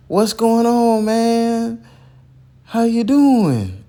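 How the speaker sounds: high, hyped-up phone hello, slowing on doing; excited greeting. Retold or imitated. Mentor greeting Percy on the phone, high excited hello. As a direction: imitate a big enthusiastic welcome.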